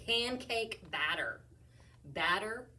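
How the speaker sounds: usual speaking voice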